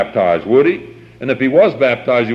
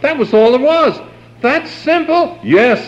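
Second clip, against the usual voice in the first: emphatic exclamation; 12 semitones higher than usual. Real, not acted. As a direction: pitch leaping high to drive the point home. Preacher exulting over a simple message.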